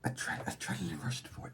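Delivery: whispered in awe; hushed whisper. Real, not acted. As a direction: breathe the name under your breath. Hushed repeat of card name.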